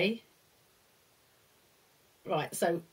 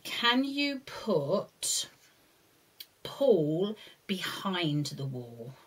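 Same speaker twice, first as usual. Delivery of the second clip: drawn-out hushed question; slow and hushed; 2 semitones lower, much slower than usual. Shown as a demonstration. Instruction to child.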